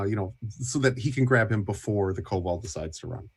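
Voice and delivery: ordinary delivery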